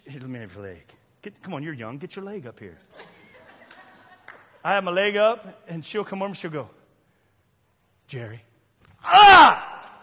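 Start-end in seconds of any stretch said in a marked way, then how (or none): 0.1-2.8 very soft, low murmur; mock coaxing wife. Voices wife coaxing him softly. 8.1-8.4 hushed, low name-call; imitated wife calling softly. Wife softly calls sleeping husband. 9.0-9.9 sudden high, loud cry of fright; mock jolted-awake scream; startled yell.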